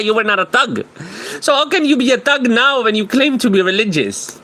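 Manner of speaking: high, heated mocking rant. Real, not acted. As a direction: tear into him, pitch climbing.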